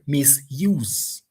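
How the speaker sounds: "misuse" said slowly, stress on the second syllable, "use"; stress on root. Shown as a demonstration. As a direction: lean on "use" in "misuse", not the prefix "mis". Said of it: "That is the word we will stress".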